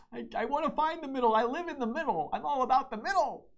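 high silly voice. Pretend. Playful mock voice about middle.

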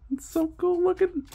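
very high, soft squeal of delight; gushing. Real, not acted. Card opener adoring the new lands, high gushing squeal. Gushing over favourite land cards.

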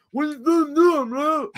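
high, aggressive imitation of a brother; mock angry challenge. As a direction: call him out, squaring up. Brother confronting him, quarrel retold.

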